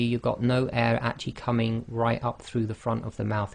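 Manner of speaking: normal talking voice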